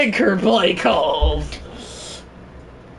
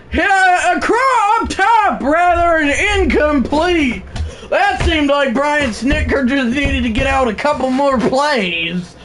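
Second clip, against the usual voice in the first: usual speaking voice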